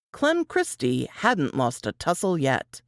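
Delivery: ordinary delivery, nothing marked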